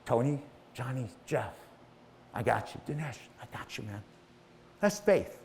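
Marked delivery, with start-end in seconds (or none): 0.1-4.0 softer, lower reassuring voice; soft inner voice. Retold or imitated. The voice in your head reassures each listener softly. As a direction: murmur the names gently, reassuring. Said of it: "this voice in the back of your head".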